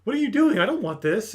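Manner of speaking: high, loud imitated alarm; mock distressed protest. Elf protesting as blood gets rubbed on her, high mock distress.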